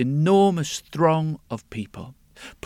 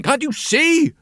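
indignant king; 11 semitones higher, 4 dB louder than usual. Acted.

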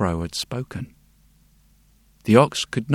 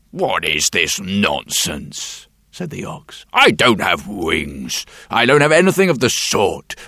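gruff ox; 5 dB louder than usual. Acted. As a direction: protest like a stubborn ox. Ox rejects the idea.

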